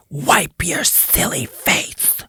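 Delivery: slow spooky whisper; ghostly whisper. Retold or imitated. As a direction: whisper it like the recording. Reading ghost answer options.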